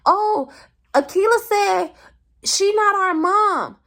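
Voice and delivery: high mocking kid voice drawn out; tattling kid siblings. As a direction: mimic little kids telling on their big sister. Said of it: "my siblings couldn't wait till my mom got back home, like".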